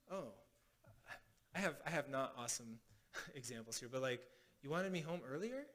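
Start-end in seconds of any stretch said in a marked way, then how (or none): 4.6-5.7 soft echo question, EARLIER rising at the end; mirroring question. Shown as a demonstration. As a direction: repeat it back quietly as a question, lifting on EARLIER. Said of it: "we say it as a question".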